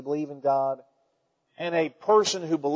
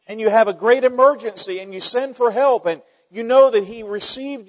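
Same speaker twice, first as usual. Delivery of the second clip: higher, louder building sermon; fervent preaching; 5 semitones higher, 4 dB louder than usual. Acted. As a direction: drive it home to the congregation.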